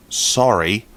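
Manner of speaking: normal talking voice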